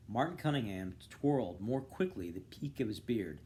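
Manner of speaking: plain reading voice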